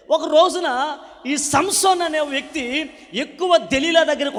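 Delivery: loud, high, ringing preacher's cry; fervent revival preaching, loud and lifted; full-force preaching. Acted. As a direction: preach it out to the whole hall. Preaching story.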